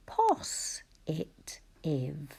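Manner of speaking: "pos it iv" broken into separate syllables; spelling voice. Shown as a demonstration. Teacher giving positive a spelling language, saying "pos it iv" in separate syllables. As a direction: sound out "pos", "it", "iv" one by one. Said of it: "give it a spelling language and say".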